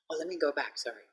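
ordinary talk

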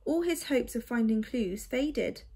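plain reading voice

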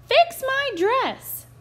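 high, loud and slow demand; shouting stepsister. A narrator's reading. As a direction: shout it like a spoiled brat. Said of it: "shouted the other".

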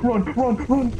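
loud high urging; being chased. Real, not acted. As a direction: yell run, fleeing. Fleeing monster.

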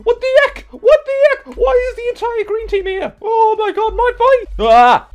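very high, loud panicked shriek. Real, not acted. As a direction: shriek as the whole team swarms you. Whole enemy team appears.

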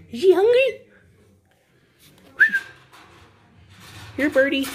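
high, loud-ish pet voice. Real, not acted. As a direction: squeal it playfully to a bird. Baby talk to pet bird.

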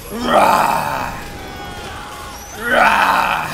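rough roar into villain cackle; roar, then a long staccato evil laugh; hammed-up monster roar and laugh; battle roar. Attacking enemies in game.

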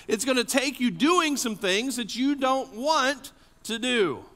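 rising, urgent sermon delivery; full-force preaching. Acted. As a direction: drive it home to the congregation.

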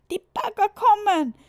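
high, loud warning scream; children screaming. A narrator's reading. The children scream that the diggers are coming. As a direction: shout the alarm like frightened children. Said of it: "schrien die Kinder".

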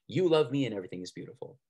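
ordinary talk

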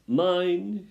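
high, loud possessive cry; calling out. A narrator's reading. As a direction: claim it loudly before the pack. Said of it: "called out to the other rats".

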